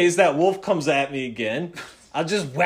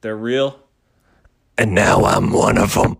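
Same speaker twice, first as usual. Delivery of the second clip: gravelly voice; 9 semitones lower than usual. Acted. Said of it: "You're doing that gravelly voice".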